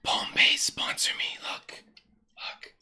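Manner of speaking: hushed, unvoiced sponsor plea; mock ASMR whisper. Streamer courting a drink sponsor, playful whisper. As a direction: whisper it close to the mic.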